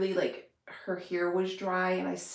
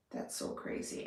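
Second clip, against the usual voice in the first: hushed, breathy awe; awed hush; 12 dB quieter than usual. Retold or imitated. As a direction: say it under your breath in amazement. Stunned self in dream.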